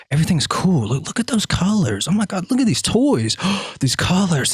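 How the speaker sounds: put-on high excited kid voice; wide-eyed child amazement; childlike wonder. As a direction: gasp at the colors like a toddler. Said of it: "the same filter that a child".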